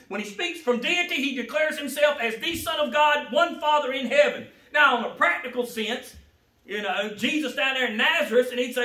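loud, rising preacher's cry; preaching at full force. Acted. Preacher exhorting the congregation, loud and rising. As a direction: preach it out to the church.

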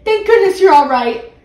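high, loud relieved exclamation; relieved cry. A narrator's reading. Girl bursting into the bathroom, crying out in loud relief. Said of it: "cried Judy".

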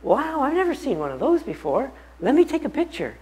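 high, excited wife voice; wife's voice. Retold or imitated. Husband mimics wife's amazement at the note. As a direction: raise the voice high to play the wife. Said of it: "my wife said".

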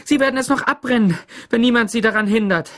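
high, fast, loud alarm; breathless cry. A narrator's reading. Teacher begging the sheriff to stop the mob, crying out breathlessly. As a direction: rush it out, urgent and high. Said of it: "rief sie atemlos".

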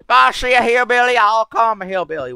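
loud, high-pitched mock hillbilly; hillbilly accent. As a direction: holler it in a hammed-up hillbilly accent. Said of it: "I literally can do accents".

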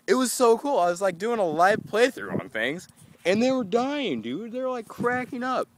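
high thrilled chatter; excited. Real, not acted. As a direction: brag about the crowd. Excited about audience laughing.

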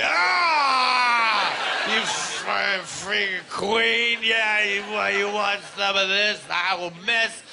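screaming, choked-up mock meltdown; losing it. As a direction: sputter with hammed-up fury. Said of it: "loses it".

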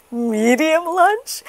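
usual speaking voice

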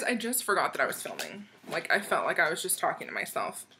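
ordinary talk, nothing marked

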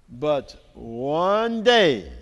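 ordinary delivery

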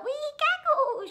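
very high, loud witchy squeal; playful piercing witch chorus; little witches' shriek. A narrator's reading. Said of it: "shrieked the six".

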